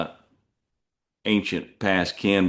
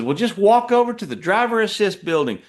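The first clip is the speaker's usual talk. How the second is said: high, put-on clerk voice; high mock-helpful office voice; quoting the clerk; 12 semitones higher, 4 dB louder than usual. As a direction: mimic the dispatcher sweetly up high. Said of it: "And she says".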